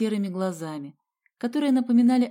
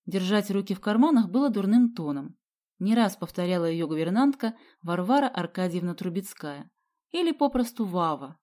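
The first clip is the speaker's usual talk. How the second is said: usual speaking voice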